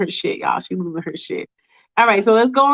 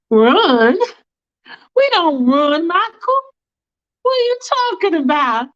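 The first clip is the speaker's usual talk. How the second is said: high, indignant retort; defiant high Faith; 8 semitones higher than usual. Acted. Faith rejects Michael's plan to run, high and indignant. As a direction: scoff at the idea of running.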